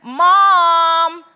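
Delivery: put-on loud, high call of a boy in trouble; calling out to mom. Little boy in the bathroom calling his mom, loud and high. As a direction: yell for mom, half panicked.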